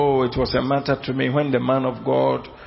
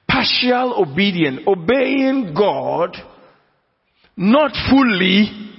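higher, louder, slow and deliberate; emphatic preaching; 6 semitones higher, 4 dB louder, slower than usual. Acted. Preacher drilling the point into his congregation, emphatic and raised. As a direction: hammer each word home from the pulpit.

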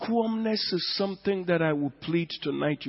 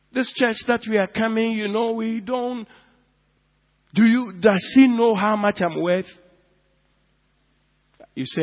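ordinary talk

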